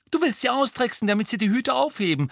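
high, agitated outburst; angry accusation. A narrator's reading. Ron accusing Hermine of tricking elves, angry. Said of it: "sagte Ron wütend".

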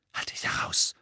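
unvoiced, insistent whisper; urgent hiss. A narrator's reading. Hermine warns Harry off mid-class, hissing urgently. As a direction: hiss a warning under your breath. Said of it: "zischte ihm Hermine eindringlich zu".

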